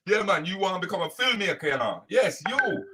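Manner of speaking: lightly voiced doting father, gushing; mock a proud parent hyping up his boy; proud dad to his son. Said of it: "you was mostly talking to your youth and going".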